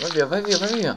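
panicked shouting. Real, not acted. Scared player shooing off monsters.

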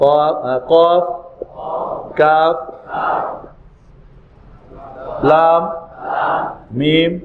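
drawn-out letter names, each set apart; letter-name recitation. Shown as a demonstration. Teacher leading learners through Arabic letters, measured recitation. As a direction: call out each letter for repetition.

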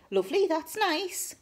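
high, chirpy oblivious approval; pretend enthusiasm. Turning to an uncle who says it's painful, obliviously cheerful. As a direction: chirp it as if it were good news. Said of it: "Pretending to be really enthusiastic about what he's telling me".

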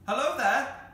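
high, loud hail from afar; shouting. A narrator's reading. Cyril shouts from the church tower, loud hail. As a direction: holler down from a height. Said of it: "shouted Cyril from the church tower".

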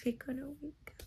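breathy late-night whisper. Real, not acted. Whispering to viewers at night about the alarm. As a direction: whisper it so nobody wakes.

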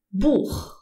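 Buch ending in throaty friction; back-throat ch. Shown as a demonstration. Teacher giving learners an example, Buch with throaty ch. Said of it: "you pronounce it".

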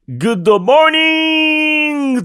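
very high, long held "morning"; big showman greeting. Acted. Host to listeners opening the show, exuberant drawn-out greeting.

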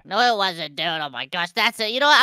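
ordinary talk, nothing marked

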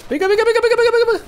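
high, rapid-fire venga chant; squeaky excited cheering; frantic urging. Real, not acted. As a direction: egg the cart on, pitch way up. Urging cart forward in game.

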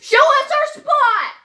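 high, loud insistent call; loud demand. Real, not acted. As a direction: demand it loudly. Demanding of mother.

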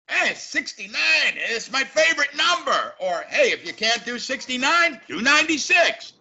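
a cartoonish American yelling a crude joke; stupid American. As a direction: blurt it like a crass tourist. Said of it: "some stupid American".

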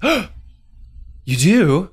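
sharp gasp, then a high startled question; high gasping disbelief; gasping surprise. Acted. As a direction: caught off guard, gasp before asking. Reacting to confession of foot warts.

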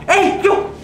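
high, loud surprised call; jolted by the crash; startled shout. Real, not acted. Watching the crash, a startled shout.

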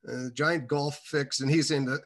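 ordinary talk

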